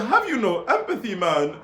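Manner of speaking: high Jamaican imitation through laughter; mock Jamaican accent. Repeating what she said, amused mock Jamaican accent. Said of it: "like, a Jamaican accent".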